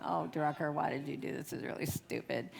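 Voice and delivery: low, soft talking to oneself; self-reproach. Retold or imitated. Self-scolding during awkward wait.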